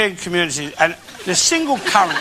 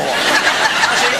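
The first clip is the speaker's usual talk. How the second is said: ordinary talk, nothing marked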